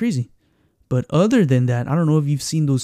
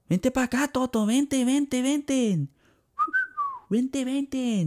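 calling the dog; 10 semitones higher, 4 dB quieter, slower than usual. Retold or imitated. Owner calls for his dog.

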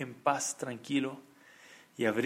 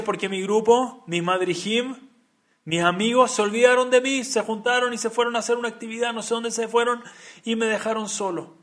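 lightly acted child's loud, higher complaint; upset child; 7 semitones higher, 6 dB louder than usual. Crying boy tells the rabbi he was left alone, mock upset. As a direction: pour it out like a left-out little boy, without tears.

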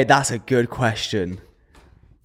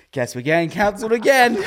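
laughing through the words; 12 semitones higher than usual. Real, not acted. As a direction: chuckle while saying it. Jokes about being cancelled.

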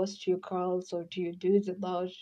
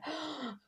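mock gasp; 7 semitones higher, 12 dB quieter than usual. Swooning over a crush.

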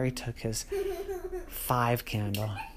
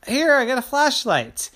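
high, loud cheerful mom; 13 semitones higher, 8 dB louder than usual. Acted. Mom hands Terry a flashlight, high and loud. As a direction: present the flashlight proudly. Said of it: "she appeared".

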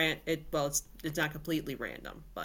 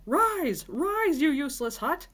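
much higher, louder barked order; furious command; 8 semitones higher, 4 dB louder, slower than usual. Acted. Koshe commands the hut.

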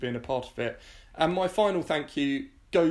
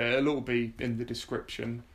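normal talking voice

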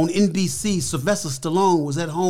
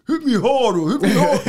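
higher, louder, laughing as Stallone; quoting Stallone; 6 semitones higher, 4 dB louder than usual. Retold or imitated. Imitating Stallone urging him at the audition, higher and laughing. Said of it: "he was saying in the audition".

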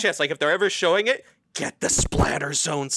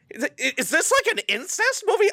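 rising high, incredulous; shocked buddy; 14 semitones higher than usual. Retold or imitated. Friend retelling buddy's shock at the movie, high incredulous. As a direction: climb higher in disbelief. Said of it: "and it's just like".

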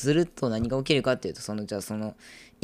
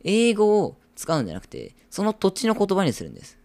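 raised, loud, forceful argument; emphatic insistence; 10 semitones higher, 8 dB louder than usual. Real, not acted. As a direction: press the point like a campaigner, high and loud. Insistent argument about world language.

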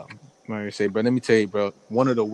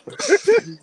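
laugh; 5 dB louder than usual. Real, not acted. Laughing at shoplifter story.

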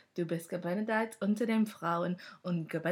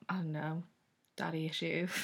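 normal talking voice

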